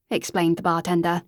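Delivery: ordinary delivery, nothing marked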